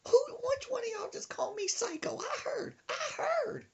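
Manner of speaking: high indignant grandma; high-pitched mock grandma fury. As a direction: confront them as the furious granny. Grandma bursts in, voiced.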